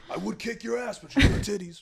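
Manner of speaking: mock tough guy. Acted tough guy quote.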